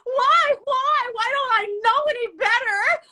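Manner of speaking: high, loud fake crying wail; mock sobbing. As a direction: sob it out like a soap opera. Mock sobbing, friend says stop.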